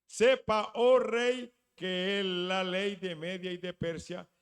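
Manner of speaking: loud, threatening warning to the king. Acted. The king's men threatening him loudly. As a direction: bark the law at the king like a threat. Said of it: "lo amenazaron".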